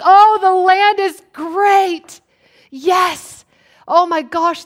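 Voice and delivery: high, loud, drawn-out delight; mock excited report. Spies back from the promised land, hammed-up excitement. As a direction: gush like a thrilled scout.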